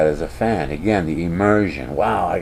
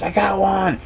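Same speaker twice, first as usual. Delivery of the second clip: high, triumphant callout; excited pretend yell; [name]'s mock scream; 11 semitones higher than usual. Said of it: "he screams".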